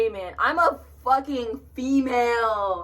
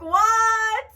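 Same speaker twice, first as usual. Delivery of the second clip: shocked what; 11 semitones higher, 3 dB louder than usual. Retold or imitated. Stranger leans in for kiss.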